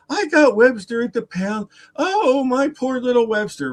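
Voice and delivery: high, tearful imitation of mourning; mock grieving wail. Owner on his knees with dead dog, mock wailing. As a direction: lament it like an overwrought pet owner. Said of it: "he was on his knees holding the dog up".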